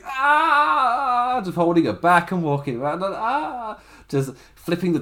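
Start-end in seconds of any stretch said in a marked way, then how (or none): 0.1-1.4 long, very high imitated scream; mock scream. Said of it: "and just screaming". 3.2-3.8 high, long imitated wail; mock pained scream. Retold scream of pain while walking.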